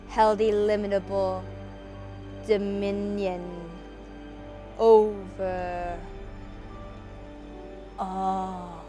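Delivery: ordinary delivery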